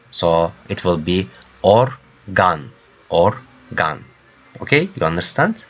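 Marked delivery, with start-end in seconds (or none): 1.6-4.0 "organ" slowly in two parts, or, gahn; Turkish full A. Shown as a demonstration. Teacher models for learners, "organ" slowly as or-gahn. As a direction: split "organ" and open the a to ah. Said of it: "I have to pronounce as A".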